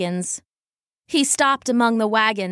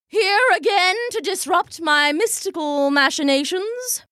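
intoning; 8 semitones higher than usual. Acted. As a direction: intone it for the whole crowd. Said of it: "she intoned".

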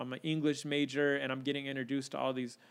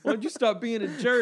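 louder, laughing stern dad; dad mode; 8 semitones higher, 6 dB louder than usual. Retold or imitated. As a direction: play the scolding dad while laughing. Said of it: "You hurt my feelings".

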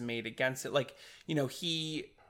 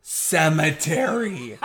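loud, slow, laughing exclamation; triumphant exclamation; 2 semitones higher, 7 dB louder, slower than usual. Real, not acted. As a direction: draw the word out in loud relief. Delighted at recovering the forgotten word.

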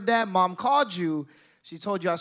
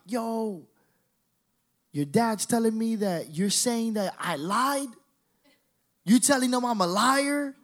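retold confrontation; 6 semitones higher than usual. Cousin confronts him on the phone.

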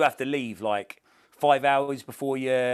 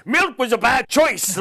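put-on a higher, slow, louder Scottish-accented Anchorman line; 11 semitones higher, 8 dB louder, slower than usual. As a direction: retell a friend doing Anchorman in a Scottish accent, slowed and punchy. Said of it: "he did it in a Scottish accent".

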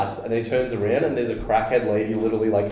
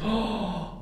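sharp gasp; 9 semitones higher, 8 dB quieter than usual. Real, not acted. Shocked by own story.

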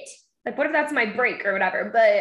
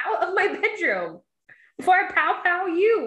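mock stern mom; 7 semitones higher than usual. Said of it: "I was like".

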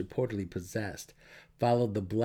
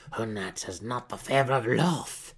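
Malice, tense; 2 semitones higher, 3 dB louder than usual. Acted. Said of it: "Malice screamed".

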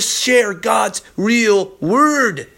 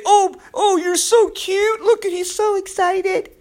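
high, mocking baby-talk coo; mocking condescending people; 13 semitones higher than usual. Preacher mocks people who belittle excited believers, in a high condescending coo. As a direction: sneer as if cooing at a toddler. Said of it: "Some people say".

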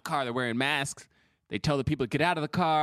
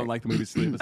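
usual speaking voice

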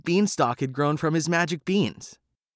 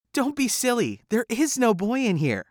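high, breezy dismissal; wife hiding Jack from the giant; wife dismissing him; 11 semitones higher than usual. A narrator's reading. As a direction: wave away his worry. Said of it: "said his wife".

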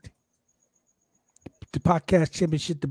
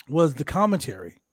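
ordinary talk

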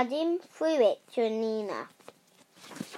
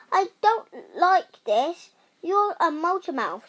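higher, louder story-book shout; shouting in protest; 6 semitones higher, 6 dB louder than usual. A narrator's reading. Rosie objecting to being turned into a motor mouth, shouting. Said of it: "shouted Rosie".

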